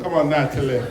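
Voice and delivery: put-on a Yankee's half-hearted stab at a Caribbean accent; attempted accent. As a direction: copy "Come on, Natalie" in your best borrowed island accent, quietly. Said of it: "that's the best accent I can do".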